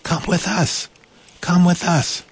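high, gentle beckoning voice, repeated; giraffes beckoning. A narrator's reading. Giraffes at the window calling Delphine away, high and beckoning. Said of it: "beckoning as if to say".

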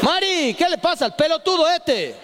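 mucho más agudo, quejándose a los gritos; llamá a la mujer indignado por el hijo; padre exasperado. Retold or imitated. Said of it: "padre le grita a la esposa". (el padre le reclama a Mari por el hijo, agudo)

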